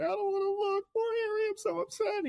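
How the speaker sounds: very high-pitched, whimpering fake sob; mock crying. Neville too scared to watch Harry, high mock crying. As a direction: wail it like a hammed-up crybaby. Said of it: "he's crying".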